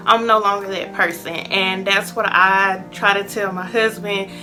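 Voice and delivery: normal talking voice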